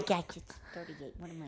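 hushed whisper, nearly silent. Real, not acted. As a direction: whisper so he can't hear. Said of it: "Why are you whispering?".

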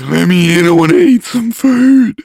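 high, slow creepy inhaled voice; speaking on inhale. Shown as a demonstration. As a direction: inhale the words like a spooky mummer. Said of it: "we have to talk in".

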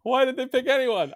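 put-on high-pitched baffled kid question; bewildered child. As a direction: demand an explanation like a confused child. Acts bewildered son asking again.

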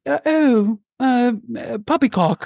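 high, stammering upper-crust British. Acted. Host demonstrating British accents, upper crust. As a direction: splutter like a British toff. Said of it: "the, the upper crust".

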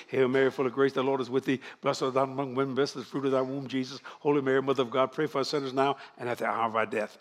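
fast, low, fading rote recital; rattled-off prayer. Shown as a demonstration. Preacher rattling off the Hail Mary fast. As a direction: rush the prayer to get out of confession. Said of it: "Notice I'm saying it fast".

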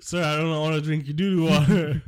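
high, loud, put-on voice refusing; mocking a guy refusing. Friend mocks a man refusing the dirty water, high and loud. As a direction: squeal it out, disgusted and polite. Mock reply to the senator.

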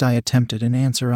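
ordinary delivery

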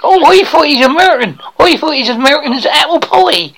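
eager would-be actor. Acted. Aspiring actor boasts to coach about school play, eager bumpkin voice. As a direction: play a naive hopeful with a thick country accent.

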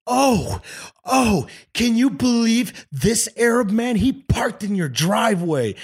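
hammed-up shocked busybody voice; mock outraged lady. Angry neighbour lady complaining to the girlfriend, hammed-up and indignant. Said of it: "And she goes".

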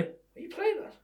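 a comic officer's high-pitched challenge; mock policeman. Policeman challenging a suspect friend, high mock disbelief. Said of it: "You be the policeman".

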